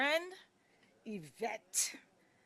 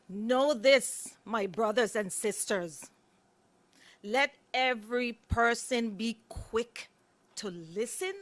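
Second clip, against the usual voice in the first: louder, preacherly reading of James; scripture recitation; 2 semitones higher, 6 dB louder than usual. Acted. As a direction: proclaim the verse with weight. Reciting Bible verse.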